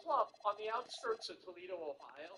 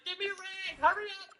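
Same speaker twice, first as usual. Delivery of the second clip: mock panic; 9 semitones higher, 11 dB louder than usual. Frantic over spill at home.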